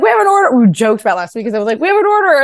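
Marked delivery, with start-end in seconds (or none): 0.0-0.6 high, loud squeal of mock alarm; mock panic. Telling friends about the first order in high mock panic. 1.8-2.4 high, loud squeal of mock alarm; mock panic. Telling friends about the first order in high mock panic. Same joke repeated.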